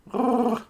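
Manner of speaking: high rolled "grrr"; trilled cat call. Real, not acted. Noise made at the cat.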